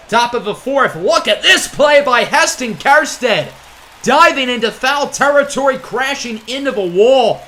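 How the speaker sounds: loud and high, a shout on the dive; excited play call. Real, not acted. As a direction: sell the highlight catch. Calling a diving catch.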